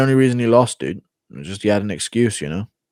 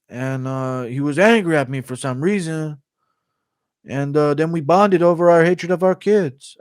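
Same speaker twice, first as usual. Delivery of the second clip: Ortega impression; 6 semitones higher than usual. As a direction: draw the story out, pitched up. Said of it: "Ortega and Till on a fishing trip impression".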